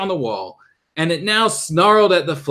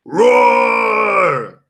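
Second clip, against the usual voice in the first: loud monster roar; 7 semitones higher, 6 dB louder than usual. Acted. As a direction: roar like a bronze beast. Demon roars at heroes.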